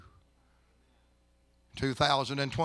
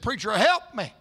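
raised, higher cry for help; desperate plea; 8 semitones higher, 7 dB louder than usual. Retold or imitated. Wife begs the preacher at the deathbed, desperate plea. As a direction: cry out as if begging for rescue.

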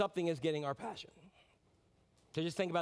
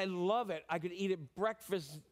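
normal talking voice

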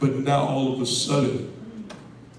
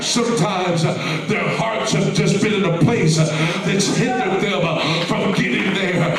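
raised, chanting cadence broken by "uh"s; rhythmic preaching; 8 semitones higher, 5 dB louder than usual. Real, not acted. Preacher on hearts hindered by life's pains, rhythmic fervent preaching. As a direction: drive each short phrase like a revival preacher.

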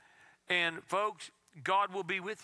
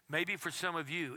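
ordinary delivery

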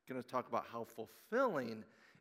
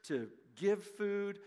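preaching; 6 semitones higher than usual. Real, not acted. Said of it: "preacher's message build".

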